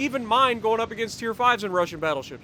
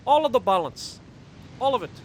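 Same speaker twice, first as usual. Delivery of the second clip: mock Russian accent; 2 semitones higher, 4 dB louder than usual. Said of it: "my horrible attempt at a Russian accent".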